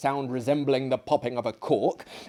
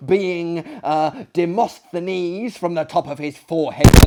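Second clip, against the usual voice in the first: louder, slow, emphatic teller; loud eager guest; 4 semitones higher, 7 dB louder than usual. Acted. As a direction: declaim the tale with relish. Another member tells Buffon tale.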